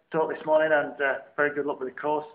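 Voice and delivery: ordinary delivery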